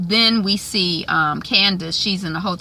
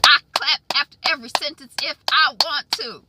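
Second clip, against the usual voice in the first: high, punched-out separate words; emphatic word-by-word; 8 semitones higher than usual. Retold or imitated. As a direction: hit each word like a handclap. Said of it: "the woman from Insecure".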